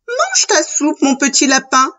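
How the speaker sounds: lightly voiced mother, starting high; mother's bidding. A narrator's reading. Mother to little rabbit at supper, lightly acted high bidding. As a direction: start high, then ease down.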